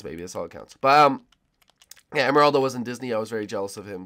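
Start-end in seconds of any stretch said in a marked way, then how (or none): 0.0-0.8 much softer, tossed off low; quiet aside. Real, not acted. Gamer repeating the verdict to himself, softly. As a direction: drop it to a soft murmur.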